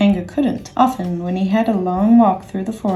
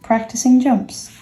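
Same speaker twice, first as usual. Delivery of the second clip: usual speaking voice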